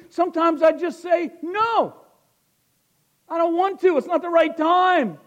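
high, very loud preaching shout; full-force preaching. Acted. Preacher telling the church how Isaiah answered God, full-force preaching. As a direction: thunder the scripture out to the congregation.

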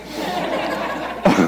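quiet unvoiced laugh at his own joke; breathless chuckle. Real, not acted. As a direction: let out a soft breathy laugh.

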